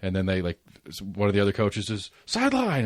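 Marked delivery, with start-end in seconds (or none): 2.2-2.8 high-pitched imitation of a sideline yell; mock coach yell. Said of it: "yelling and screaming".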